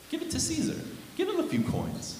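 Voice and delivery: high, soft, throwaway delivery; offhand dismissal. Retold or imitated. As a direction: toss it off lightly as no big matter. Voicing Jesus shrugging off the coin.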